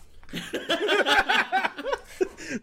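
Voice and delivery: high burst of giggling; stifled giggles. Real, not acted. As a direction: giggle you can't hold back. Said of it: "trying not to laugh".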